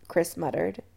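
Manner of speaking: usual speaking voice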